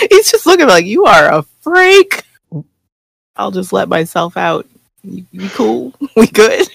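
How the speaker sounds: giggling through the words; barely holding back laughter; stifled giggles. Real, not acted. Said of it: "trying not to laugh again".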